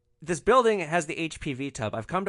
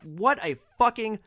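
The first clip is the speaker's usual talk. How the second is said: higher, emphatic outrage; indignant anger; 7 semitones higher, 4 dB louder than usual. Retold or imitated. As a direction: spit out your disgust at him, word by word. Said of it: "This pissed me off so much".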